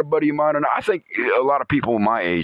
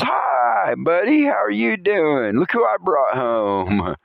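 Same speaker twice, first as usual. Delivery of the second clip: sweet talk to snake; 9 semitones higher, slower than usual. Retold or imitated. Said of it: "rubs that snake's head and says".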